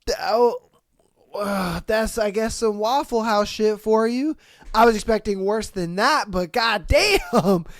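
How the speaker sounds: ordinary delivery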